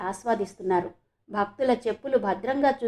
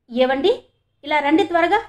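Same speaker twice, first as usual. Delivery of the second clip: higher, louder urgent call; 5 semitones higher, 6 dB louder than usual. A narrator's reading. Leelamma summons her husband quickly, urgent calling out. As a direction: call to someone in another room. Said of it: "అంటూ భర్తని పిలిచింది".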